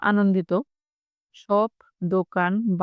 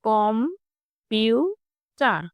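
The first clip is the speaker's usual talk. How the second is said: কম-পিউ-চার in three slow, separate beats; split into syllables; much slower than usual. Shown as a demonstration. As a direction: pause after each part of কম-পিউ-চার. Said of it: "তিনটি syllable". (কম-পিউ-চার তিন ভাগে ভেঙে ধীরে)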